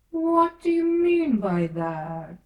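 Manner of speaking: slow, loud, high demand; stern caterpillar voice, drawn out. A narrator's reading. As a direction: press her for an answer, slowly and loudly. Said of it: "said the caterpillar sternly".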